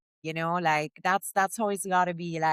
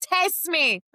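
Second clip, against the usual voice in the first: higher, louder mock dare; cocky retort; 10 semitones higher, 5 dB louder than usual. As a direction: toss the challenge straight back. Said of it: "I'm just like".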